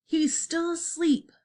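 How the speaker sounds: usual speaking voice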